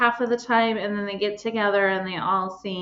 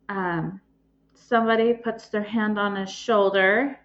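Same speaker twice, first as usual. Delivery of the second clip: ordinary talk, nothing marked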